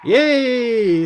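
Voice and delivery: a high, loud little yay; excited cheer. Real, not acted. Player cheering a good result, excited yay. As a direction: celebrate the win out loud. Cheering own game move.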